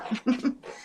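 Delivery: short amused chuckle; quick giggle at her own joke; light laugh. Real, not acted. Jokes about Shrek movie.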